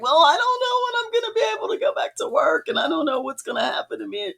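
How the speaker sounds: very high, fast mock wail; retold upset. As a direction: imitate yourself fretting in a squeaky rush. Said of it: "all upset".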